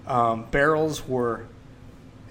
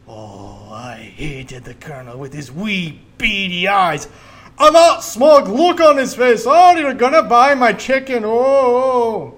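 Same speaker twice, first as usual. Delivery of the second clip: high, very loud Scottish rant; ranting Scottish accent; 6 semitones higher, 13 dB louder, slower than usual. Retold or imitated. Movie dad raging about the Colonel, in a loud Scottish accent. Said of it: "doing this Scottish accent".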